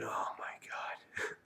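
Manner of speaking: breathless whispered dismay, very soft; inner-thought whisper. Retold or imitated. Grandson spitting coffee in grandpa's new car, whispering. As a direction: whisper it as a horrified private thought. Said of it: "I'm thinking".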